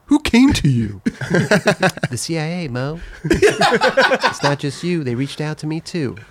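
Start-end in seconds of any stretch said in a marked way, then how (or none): 0.1-1.0 character voice. Acted. Host voices Mo confronting Richie, higher. Acting scene from the book. 3.2-6.2 slow, raised confession; character voice. Acted. Host voices Richie's reveal, slow. Acting scene from the book.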